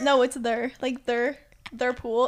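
"there" and "their" said "thur". Retold or imitated. Arguing back to Sam, "there" as "thur". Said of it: "I'm like".

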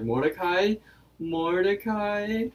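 high, questioning, increasingly drawn-out call; acted worried calling. Rigby calling to fallen Mordecai, acted worried calling. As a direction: call a friend's name, pitch climbing. Rigby calls to his slain friend.

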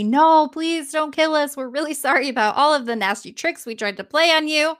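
hammed-up pleading; begging for mercy. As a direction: beg for your life. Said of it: "begging for mercy".

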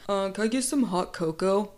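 half-convincing masculine man impression; questionable man voice. Picturing the most masculine man ordering cocoa, dubious man voice. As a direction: lightly put on a man's voice, not quite pulling it off. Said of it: "My man voice sounds a little questionable".

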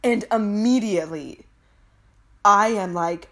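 ordinary talk

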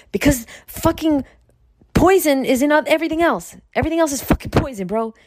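high-pitched, emphatic acted retort; heated meeting comeback. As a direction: argue back at investors, hammed-up and insistent. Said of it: "And I'd be like".

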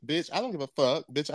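usual speaking voice

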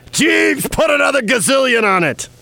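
rich big shot. Pretend. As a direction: call to your butler like a high roller. Playing a rich gambler ordering butler.

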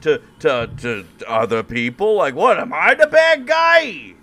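put-on high, loud, slurring drunk rant; belligerent drunk. Voicing a drunk bigot in antebellum era.

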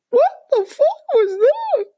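slow high diva shriek. Pretend. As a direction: squeal it up in the whistle register like a diva. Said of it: "Now I sound like [name]".

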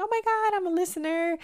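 high, breathless-fan squeal; imitating excited fan. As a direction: squeal it like a thrilled listener. Acted quote of excited listener.

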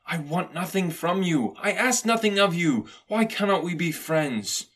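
high-pitched, heartfelt appeal; earnest plea. A narrator's reading. Nephew asks Scrooge to be friends, pleading. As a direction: plead openly, slowing at the end.

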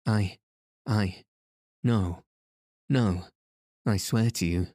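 lightly halting, scared denial; frightened stammer. Acted. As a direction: stumble over the words in fear. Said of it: "and stammered".

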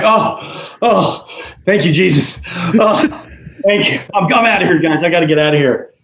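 high, gasping mock relief; breathless relief. Scared diver as lights come on, panicky relief. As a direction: imitate a spooked man desperate to leave.

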